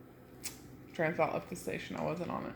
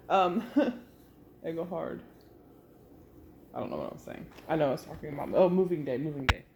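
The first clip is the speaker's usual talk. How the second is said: ordinary delivery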